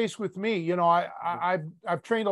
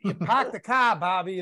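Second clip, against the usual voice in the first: put-on teasing Boston accent with dropped Rs; hammed-up Boston accent; mock Boston accent. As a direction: imitate Boston speech, R-less "park the car".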